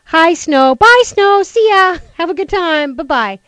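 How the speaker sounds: high, loud singsong goodbye to the snow; cheery send-off. Retold or imitated. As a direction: wave the snow off like a cheerful host. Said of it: "But it's like".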